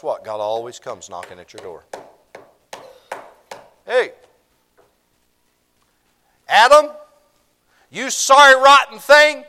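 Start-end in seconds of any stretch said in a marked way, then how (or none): 3.8-9.4 high full-voiced yell across the garden; bellowing down the garden; God yelling. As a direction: call him out loud and high from far off. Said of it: "He could have yelled that".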